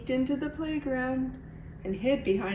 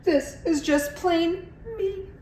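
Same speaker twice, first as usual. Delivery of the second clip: higher, slow bashful plea; shy cry; 9 semitones higher, slower than usual. A narrator's reading. Iguana insisting she wears no costume, high slow cry. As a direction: protest shyly. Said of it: "cried Iguana".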